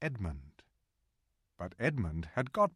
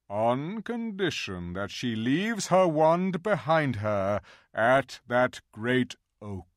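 lion king speaking slow, louder and firm; a regal lion granting terms with slow authority; Aslan; 4 semitones higher, 7 dB louder, slower than usual. A narrator's reading. Aslan's lines, read aloud.

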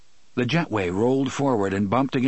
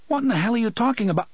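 high, fast burst of alarm; alarmed outburst; 11 semitones higher, much faster than usual. A narrator's reading. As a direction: blurt it out in panic.

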